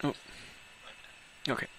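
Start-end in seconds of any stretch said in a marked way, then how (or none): none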